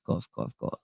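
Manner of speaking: whispered fake cough words.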